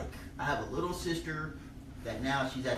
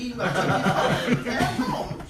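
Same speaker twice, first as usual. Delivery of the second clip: laughing; 8 semitones higher, 8 dB louder, slower than usual. Retold or imitated. Urging, slow laughing.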